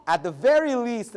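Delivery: ordinary talk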